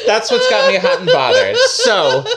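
high, loud, laughing through it. Real, not acted. Host cracking up at her own joke, laughing. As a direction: laugh out the words.

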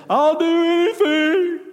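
high, loud, slow mocking whine; mock his dramatic promise, way up high; exaggerated pleading whine, drawn out; mocking whiny plea. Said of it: "you said".

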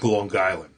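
"Long Island" run together as "Lawn Guyland"; hard G. Shown as a demonstration. Explaining the Queens accent to a friend, hard G in "Long Island". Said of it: "the hard G's".

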